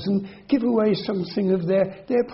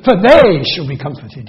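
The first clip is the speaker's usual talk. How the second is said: "they" hit much louder, then fall away; stress on they; 10 dB louder than usual. Shown as a demonstration. Preacher reading Beatitude aloud, loud accent on "they". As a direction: punch "they" in "for they shall be comforted". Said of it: "we'd get it by accent".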